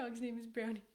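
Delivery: usual speaking voice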